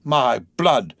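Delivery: vexed exclamation. A narrator's reading. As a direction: exclaim in mild vexation. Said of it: "ejaculated the vexed coachman".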